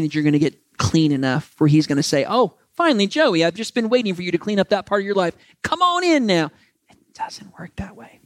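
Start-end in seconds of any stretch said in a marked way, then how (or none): 2.3-6.5 voiced God beckoning, pitch climbing high; mock relieved God. Imagined God finally welcoming preacher. 6.9-8.2 hushed whisper for emphasis; soft emphatic whisper. Real, not acted. Repeated line, now whispered.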